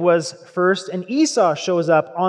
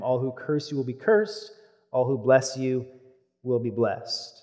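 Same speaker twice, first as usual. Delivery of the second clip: softer, lower, lingering on each phrase; quiet repeat; 7 semitones lower, 8 dB quieter than usual. Shown as a demonstration. Teacher repeating the key line for emphasis, quiet. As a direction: hush it to underline the point. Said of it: "Noticing that last line".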